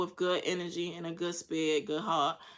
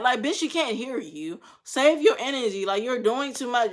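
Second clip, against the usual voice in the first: ordinary delivery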